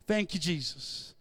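ordinary talk

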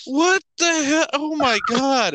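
retold shock. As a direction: stretch out the stunned outrage. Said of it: "I'm like".

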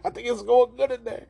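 high, loud put-on granny; hammed-up grandma impression. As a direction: pipe up as a grandma character. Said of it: "Grandma's like".

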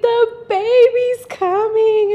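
high, loud and drawn out with joy; mother on the phone announcing the baby, high and thrilled; thrilled mom. Retold or imitated. Excited mom voiced in story.

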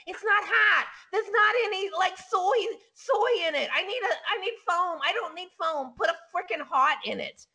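complaining millennials. Retold or imitated. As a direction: imitate a fussy millennial griping. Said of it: "they can complain about the coffee".

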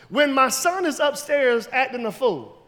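ordinary talk, nothing marked

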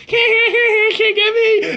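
high, drawn-out cackling taunt; hammed-up squealing mockery; mock taunting laugh. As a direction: imitate a gleeful "catch me if you can" laugh. Cousin acting out mocking laugh at game villain.

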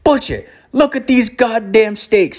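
high-pitched mock yell; acting out an indignant, high-voiced shout; imitated yelling. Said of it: "he's, like, yelling at".

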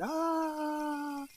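long high-pitched mock scream, held back in volume; imitowany daleki krzyk. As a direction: imitate a drawn-out wail heard from far off. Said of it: "pero se oye el grito".

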